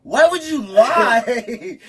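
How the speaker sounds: very slow, high and loud through laughter; laughing accusation. Retold or imitated. Partner asking why he lied about the food, laughing. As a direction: drag it out while cracking up.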